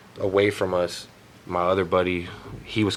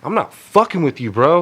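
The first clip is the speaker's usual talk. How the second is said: higher, louder imitated protest; heated insistence; 6 semitones higher, 6 dB louder than usual. Tripping friend insisting to the dude confronting him, heated. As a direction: push back hard as the accused friend. Acted quarrel at the party.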